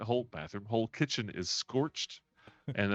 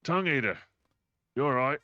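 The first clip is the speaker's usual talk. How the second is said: a voice calling out, higher and louder; mild yell; 4 semitones higher, 5 dB louder, faster than usual. Acted. Game master voices an unseen newcomer, mild yell. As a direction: call out to check on a companion. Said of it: "one of the voices yell out".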